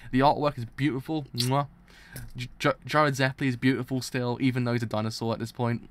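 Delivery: ordinary talk, nothing marked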